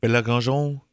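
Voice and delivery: improvised fake French accent. Shown as a demonstration. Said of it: "like, in a French accent".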